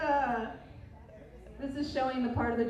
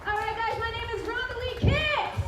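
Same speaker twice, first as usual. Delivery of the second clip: high, louder emcee hype intro; bigger, higher take of the self-introduction; hyped intro; 11 semitones higher, 3 dB louder than usual. Shown as a demonstration. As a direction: announce your name like a big host. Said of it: "repeats the intro, building".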